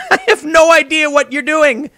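high, laughing bewilderment; laughing in disbelief. Real, not acted. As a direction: laugh while saying you're lost. Mocking rioters, laughing.

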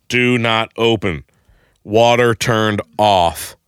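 slow, word-by-word dictation; writing it out. Retold or imitated. Man writing Post-it notes for the door, slow word-by-word reading. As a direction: space the words out as if penning each one.

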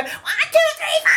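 high, loud shrieked count-off; punk singer's yell. Retold or imitated. Punk fan imitating a singer for annoyed cafe customers, high mock shriek. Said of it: "Julie Rubin go".

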